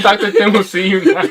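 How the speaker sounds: playful receptionist bit, cracking up; laughing mock receptionist. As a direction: call the patient in through laughter. Plays receptionist while laughing.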